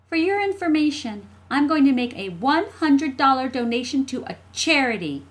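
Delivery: put-on high, loud, self-important child; smug little sister. Jessie smirking at her brother about charity, smug. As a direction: announce it grandly to show him up.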